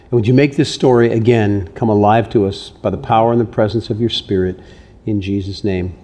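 hushed pastoral prayer. Real, not acted. Pastor prays over congregation.

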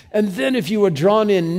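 a light English accent on the line; a hint of an English accent. Shown as a demonstration. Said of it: "try it with an English accent".

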